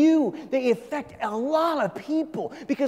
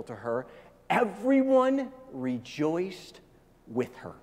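scripture reading; 2 semitones lower than usual. Acted. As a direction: read the verse gently, letting it fall away. Reads Luke aloud.

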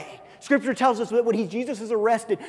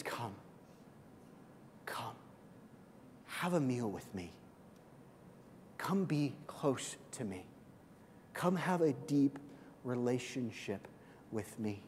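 very soft, tender repeated come; whisper-close welcome; gently drawing them near; hushed invitation; 7 semitones lower, 15 dB quieter than usual. Retold or imitated.

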